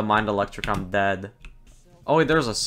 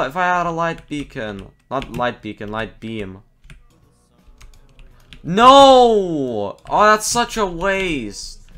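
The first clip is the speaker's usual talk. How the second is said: dismayed shout; 5 semitones higher, 5 dB louder, slower than usual. Real, not acted. Dismayed crying out at loss.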